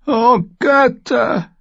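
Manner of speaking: slow, very high wail; wailing old woman. A narrator's reading. Old woman despairs in the besieged hall, wailing. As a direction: lament loudly, drawn out. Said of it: "jammerte eine alte Frau".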